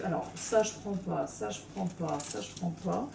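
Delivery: soft repeated asides, trailing off; muttering to herself. Real, not acted. Vlogger sorting her bag, muttering quietly. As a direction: mumble the rejections under your breath. Sorting items aloud while packing.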